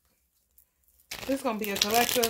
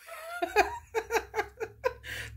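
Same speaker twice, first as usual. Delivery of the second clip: high-pitched squealing laugh; 4 dB quieter than usual. Real, not acted. Laughing at the news clip.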